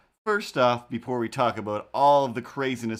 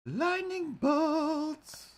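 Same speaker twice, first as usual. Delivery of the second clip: very high, very slowly stretched out; drawn-out call; much slower than usual. Pretend. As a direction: sing it out long and high like an intro fanfare. Dramatic intro announcement.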